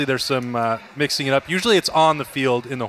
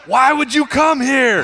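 slow, high, loud laughing yell; retold tunnel taunt, yelled high and slow; mock yelling; 11 semitones higher, 5 dB louder, slower than usual. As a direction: holler the taunt slowly up the tunnel. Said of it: "is just yelling".

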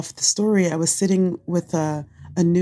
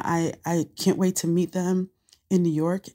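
normal talking voice

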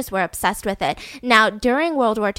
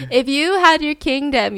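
usual speaking voice

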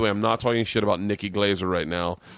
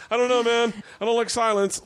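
much higher, pleading; high, pitiful mock of himself; whiny awkward self; 14 semitones higher than usual. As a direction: squeak out a nervous excuse. Self-mocking awkward reply.

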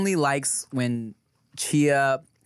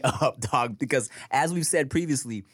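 ordinary delivery

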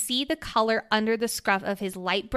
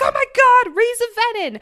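much higher and much louder, hammed-up alarm; mock freak-out; 12 semitones higher, 9 dB louder than usual. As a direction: gasp it like a wild fan theory. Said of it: "freaked the fuck out".

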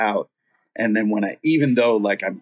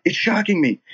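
panicked; 9 semitones higher than usual. Retold or imitated. His own panicked reply, high.

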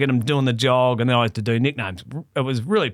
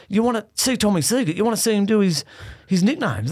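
higher pitched, exasperated mock rant; flipped out; 7 semitones higher than usual. Boss ranting at the writer who refuses, mock exasperated. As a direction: act out the boss losing it at you. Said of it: "he's just flipped".

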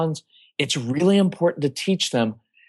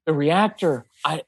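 ordinary talk, nothing marked

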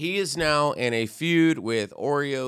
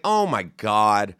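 exasperated; 5 semitones lower, 4 dB louder, slower than usual. Retold or imitated. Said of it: "I'm like".

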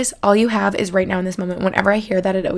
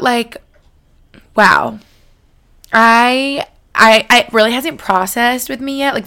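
ordinary talk, nothing marked

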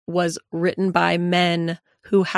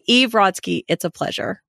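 ordinary delivery

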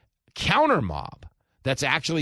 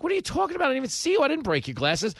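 much higher, startled protest; bewildered tourist; 13 semitones higher, 3 dB louder, much faster than usual. Retold or imitated. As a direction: protest innocence like a baffled tourist. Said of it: "the guy's like".

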